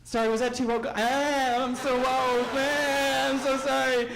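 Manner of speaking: mock apology. Comic to audience after woke joke, mock sorry. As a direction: play the fake contrition big.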